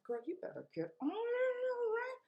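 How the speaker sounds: quick sassy pep talk, ending high; hyping herself. Retold or imitated. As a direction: egg yourself on, fast and sassy. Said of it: "So I was like".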